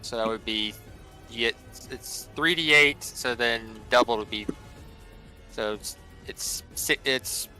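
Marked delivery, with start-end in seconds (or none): none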